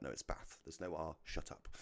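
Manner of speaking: soft half-whispered brush-off to wife; dismissive retort. Retold or imitated. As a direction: mutter it back, over it. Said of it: "And I'm like".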